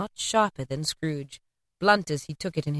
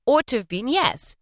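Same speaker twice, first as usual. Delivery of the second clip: plain reading voice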